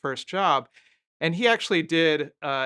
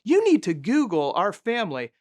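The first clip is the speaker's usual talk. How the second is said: higher, put-on voice of a threatening old lady; mock menacing widow, pitched up; imitating an older woman; 4 semitones higher, 3 dB louder than usual. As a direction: deliver the family threat like a mob matriarch. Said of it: "And she said".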